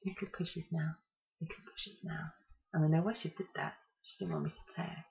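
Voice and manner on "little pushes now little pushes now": hushed, fading coaching; soft whisper. Retold or imitated. Midwife coaching the crowning mother, whispered softly. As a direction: murmur it close to the ear, gently. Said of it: "She just whispered into my ear".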